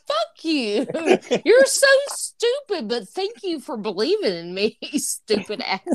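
teasing insult broken by laughs; laughing mock insult. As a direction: laugh through the playful insult. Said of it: "I'm like".

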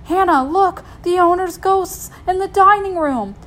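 high, loud, hammed-up fright; mock alarm. Kids scaring Hannah in the house, mock alarm. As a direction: point out a horror in pretend panic.

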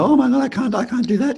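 high, giggly oh my God; giggling protest. Retold or imitated. Singapore students asked to hug, giggling no. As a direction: squeal and giggle in embarrassment. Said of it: "they all giggle and laugh and go".

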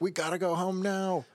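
high, mock nervous plea. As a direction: plead like you want out now. Scared character voiced in recap.

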